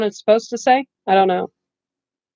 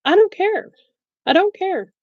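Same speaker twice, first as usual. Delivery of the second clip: higher, rising defiance, shrugging everyone off; exasperated mock-carefree outburst, pitched well up; defiant screw it; 9 semitones higher than usual. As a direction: fling it out like you're finally done trying. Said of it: "and then I was like".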